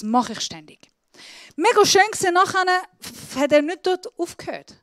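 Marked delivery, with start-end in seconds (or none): none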